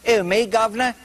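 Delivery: Cockney Judas feigning innocence. Acted. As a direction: play Judas as a sly London Cockney. Judas voiced as cockney Englishman.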